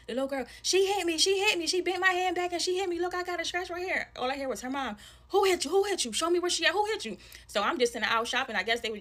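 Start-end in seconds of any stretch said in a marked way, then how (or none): 0.6-4.1 put-on high, loud little-girl complaint; imitated tattling child. Little girl telling mom she got hit, high-pitched tattling. 5.3-7.2 high, loud demand; imitated angry mother. Mother asking daughter who hit her, heated and high.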